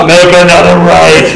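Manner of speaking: a light imitation of a booming haunted-house voice; booming guide voice. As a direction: mildly boom the direction out. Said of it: "It's still booming".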